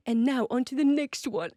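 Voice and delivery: put-on lightly done British teacher accent; a hint of the British vocal coach; imitating a British accent. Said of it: "he's British ... some kind of an accent".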